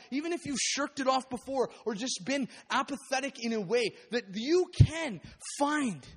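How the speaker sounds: loud building exhortation; impassioned preaching. Acted. Preacher urging the congregation to respond, loud and building. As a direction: drive the point home to the congregation.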